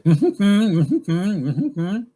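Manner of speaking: loud, high wordless hum with lips sealed; mouth-closed talking. Retold or imitated. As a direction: talk in hums through a shut mouth. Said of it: "sang with its mouth closed".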